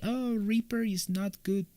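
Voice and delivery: high sarcastic imitation of critics; mocking naysayers. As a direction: parody the people who doubt Reaper. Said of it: "people say it's like".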